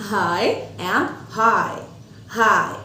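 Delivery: "high and high" stretched very slowly; very slow demo. Shown as a demonstration. Teacher modelling high and hi for kids. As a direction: draw out each "high" long and even.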